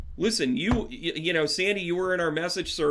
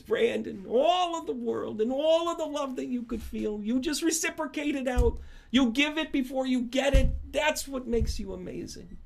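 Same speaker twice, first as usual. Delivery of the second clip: high, lilting mentor voice raised well above his own; Jamie impression; 10 semitones higher than usual. Jamie praising Brandon over lunch, high and lilting. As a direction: lift up into a sweet, admiring older lady. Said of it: "I'm gonna do my best Jamie impression".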